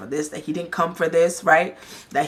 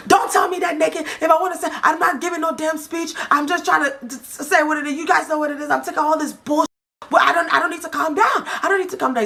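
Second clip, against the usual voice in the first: high-pitched, worked-up imitation; retold angry argument; 10 semitones higher than usual. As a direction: mimic his heated protest, voice pitched up. Acting out Swanky's heated argument.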